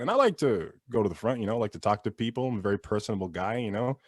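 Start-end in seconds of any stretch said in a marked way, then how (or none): none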